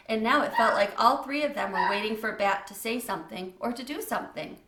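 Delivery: plain reading voice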